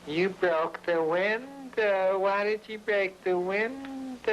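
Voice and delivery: high, slow mock hollering, pitched well up; imitating Linda hollering. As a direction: drag each word out high like a drunk girlfriend yelling at the guy. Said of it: "she says".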